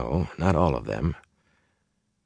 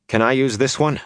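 lightly voiced youth, pitched higher; higher-voiced young man asking; eager request; 9 semitones higher than usual. A narrator's reading. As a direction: ask it with a hopeful lift. Boy asks to use sword.

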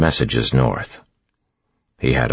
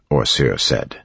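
plain reading voice

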